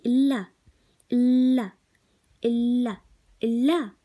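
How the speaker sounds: loud, falling اللا said over and over; repeated syllable drill. Shown as a demonstration. Teacher drilling a syllable for the class, loud and repeated. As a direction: hammer the syllable اللا each time. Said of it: "نيجي على الحرف اللي بعديه".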